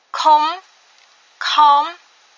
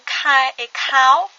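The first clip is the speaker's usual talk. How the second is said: kai and cow said slowly and louder; slow model words; 5 dB louder, much slower than usual. Shown as a demonstration. Teacher to learners, slowly modelling kai and cow. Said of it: "deux petits mots ici".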